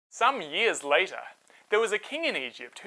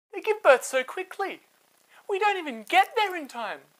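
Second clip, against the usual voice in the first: much higher, fluttery play-innocent voice; innocent midwives; 11 semitones higher than usual. Acted. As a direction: act shocked and harmless. Midwives feign innocence to king.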